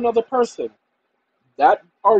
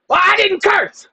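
higher, louder and slow, hammed-up clerk; mock indignant clerk; 11 semitones higher, 4 dB louder than usual. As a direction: deny it like the offended clerk. Clerk denying, retold.

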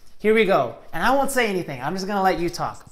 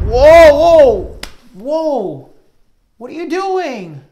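loud, higher startled whoa; startled outcry; 7 semitones higher, 12 dB louder, much slower than usual. Acted. Teacher startled as the puppet breaks a word. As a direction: shout in mock alarm.

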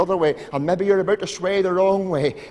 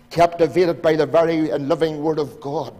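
preaching; 6 dB louder than usual. Real, not acted. Preacher urging the congregation, emphatic. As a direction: press it home from the pulpit.

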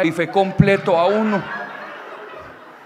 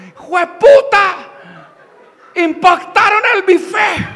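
high, slow, loud mock scream; drawn-out horrified yell, hammed up; grandmother's shocked shout; 10 semitones higher, 6 dB louder, slower than usual. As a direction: shriek it as an aghast granny. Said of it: "cuando la abuela volteó hacia mirar".

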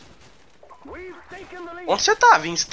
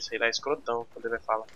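ordinary delivery

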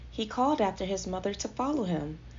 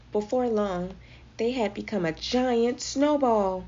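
ordinary delivery, nothing marked

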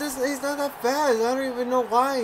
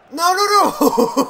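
alarmed; 8 semitones higher, 8 dB louder, slower than usual. Real, not acted. Panic at a chance.

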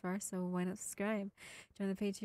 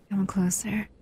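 whisper; 12 dB louder than usual. Acted. Inviting listener to lie down.

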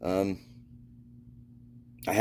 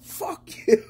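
high, strained retold cussing; high-pitched joking retort; mock comeback; 14 semitones higher than usual. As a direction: imitate a teased friend firing back, laugh following. Friend's comeback to the teasing.

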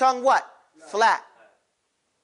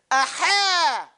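a higher aha through a laugh; 6 semitones higher than usual. Shown as a demonstration. Teacher urges the class to get loud, laughing aha. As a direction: laugh it out as you exclaim. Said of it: "Get loud".

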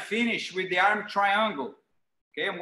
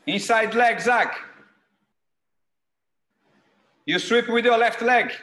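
louder coaching shout across the mat; coach calling out; 6 dB louder than usual. Real, not acted. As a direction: call out corrections to the room. Coach shouting to drilling students.

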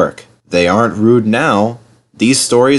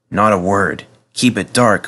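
usual speaking voice

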